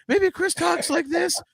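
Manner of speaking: very high, slow mock voice; co-host's normal voice. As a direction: exaggerate the co-host's voice, high and drawn out. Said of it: "What does Chris sound like".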